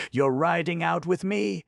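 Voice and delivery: put-on a brisk elderly aunt; older woman voice. Aunt's voice read by narrator.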